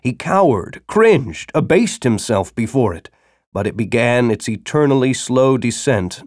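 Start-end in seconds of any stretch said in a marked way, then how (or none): none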